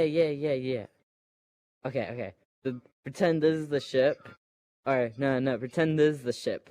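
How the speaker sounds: ordinary delivery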